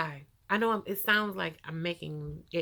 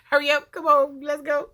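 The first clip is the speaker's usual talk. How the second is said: excited urging; 8 semitones higher, 10 dB louder than usual. Retold or imitated. Eager to leave hospital.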